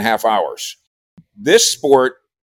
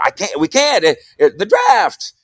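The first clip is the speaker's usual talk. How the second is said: higher, hurried list of excuses; mock-frantic, higher-pitched begging off; exasperated protest; 8 semitones higher than usual. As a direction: pile up the reasons you can't go. Protesting to wife about vacation.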